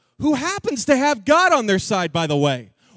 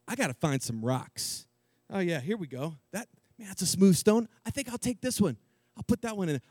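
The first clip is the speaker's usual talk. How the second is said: muttering; 5 semitones lower, 8 dB quieter than usual. Acted. David picking stones, muttering.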